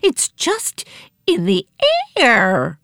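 high-pitched, swooping panic; hammed-up shrieking alarm, not full volume; frantic screaming. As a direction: report the chaos in a frantic high cry.

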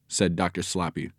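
ordinary delivery, nothing marked

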